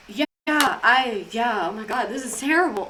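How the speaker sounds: mildly put-on solemn sympathy; feigned grief. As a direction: hastily switch to mock mourning. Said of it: "I had to switch it up literally so fast".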